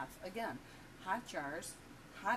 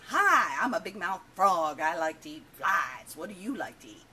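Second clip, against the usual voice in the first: big brash joke-character voice; obnoxious big-mouth frog; 14 dB louder than usual. Acted. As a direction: play an obnoxious frog, mouth wide open, booming out each phrase. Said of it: "and he's obnoxious".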